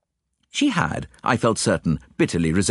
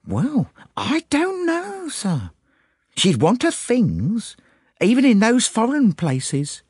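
put-on high servant-girl voice, drawn out and uncertain; simple maid, puzzled; slower than usual. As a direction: answer the gentleman slowly, thinking it through.

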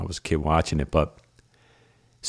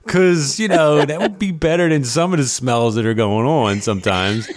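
excited inner thought; 9 semitones higher, 3 dB louder than usual. Retold or imitated. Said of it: "I'm like".

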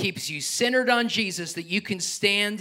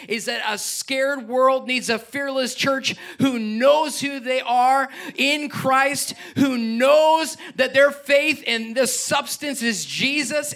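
full-force preaching; 6 semitones higher, 4 dB louder than usual. Acted. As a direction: preach it out, building the church up.

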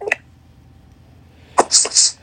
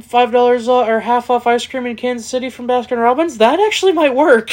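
rising excitement; 8 semitones higher than usual. Real, not acted. Excited about promo idea.

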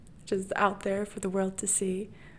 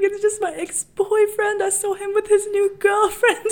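high, loud, hammed-up blubbering; comically tearful, pitched way up; mock wailing explanation; 11 semitones higher, 8 dB louder than usual. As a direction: imitate wailing out a confession. Retells tearful explanation to strangers.

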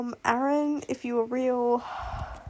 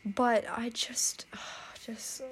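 breathless whisper; 7 dB quieter, much slower than usual. Real, not acted. Speechless sighing whisper.

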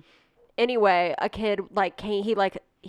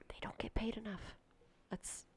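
whispered aside, much softer; 18 dB quieter than usual. Real, not acted. Host whispering about teacher pay, unvoiced. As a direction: lean in and whisper it.

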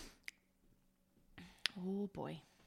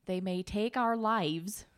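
put-on Midwestern accent; slower than usual. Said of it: "accent is Midwestern".

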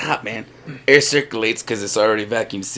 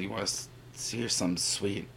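soft sultry quote; 3 semitones lower, 17 dB quieter than usual. Retold or imitated. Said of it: "he just said".

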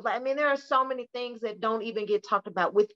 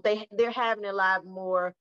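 ordinary talk

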